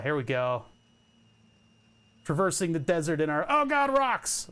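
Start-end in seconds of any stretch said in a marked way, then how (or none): 3.5-4.5 sudden high cry of alarm; startled yelp. Real, not acted. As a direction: jump at the sight of rocks. Startled by rocks in game.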